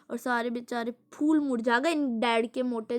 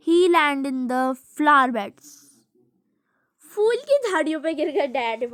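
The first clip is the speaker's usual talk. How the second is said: louder, slow, gleeful; excited read; 4 semitones higher, 8 dB louder, slower than usual. Real, not acted. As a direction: relish the fall. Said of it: "अब कहाँ गिरे ये भी देखते हैं".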